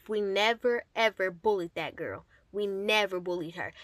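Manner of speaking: ordinary talk, nothing marked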